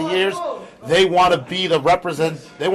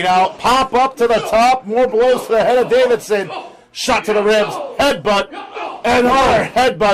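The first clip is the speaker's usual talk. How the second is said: rising excited play-by-play; lucha libre announcer; 5 semitones higher than usual. Real, not acted. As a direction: build with each blow. Live call of top-rope attack.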